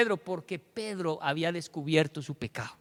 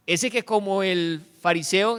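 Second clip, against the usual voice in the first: ordinary talk, nothing marked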